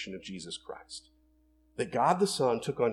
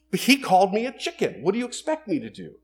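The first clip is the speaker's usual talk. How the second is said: higher-pitched, defensive protest; Marty McFly, indignant; 6 semitones higher, 4 dB louder than usual. Acted. Movie hero excusing his lost temper, indignant. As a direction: plead your case, pitched up, blaming the other guy. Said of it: "he feels like it's not his fault".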